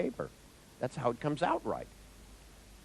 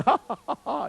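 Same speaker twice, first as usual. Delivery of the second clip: loud, high mock ha-ha; big put-on belly laugh; imitated laughter; 13 semitones higher, 8 dB louder than usual. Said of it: "They're all laughing, going".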